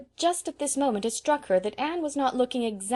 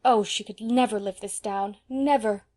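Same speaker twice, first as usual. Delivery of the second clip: usual speaking voice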